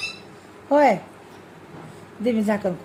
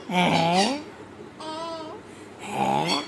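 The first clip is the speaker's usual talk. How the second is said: cat noise; 3 semitones lower, 8 dB quieter than usual. Shown as a demonstration. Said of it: "ती मांजर कशी करते".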